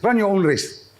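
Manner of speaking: ordinary talk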